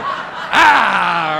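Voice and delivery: loud, high comic "aah"; mock flasher's "ah". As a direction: burst out with a hammed-up open-throated shout. Acts out flashing open the coat.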